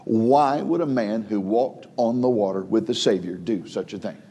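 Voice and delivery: normal talking voice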